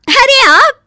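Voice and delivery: very high, loud exclamation; delighted surprise. Acted. Wife finds husband at the door, astonished. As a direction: burst out at an unexpected arrival.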